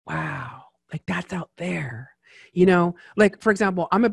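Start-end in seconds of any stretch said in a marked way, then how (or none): 0.1-2.1 softer, slow amazed wow; hushed, wide-eyed wonder; awed hush. Retold or imitated. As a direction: breathe it out like it's out of reach. Poor boy imagining big dreams.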